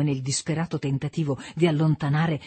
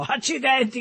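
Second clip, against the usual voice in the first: a high, cursing cry; shouted curse; 8 semitones higher than usual. A narrator's reading. Son cries out at his mother, high and cursing. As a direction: fling the curse out in a higher voice. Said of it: "gridò".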